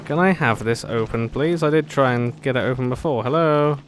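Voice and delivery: normal talking voice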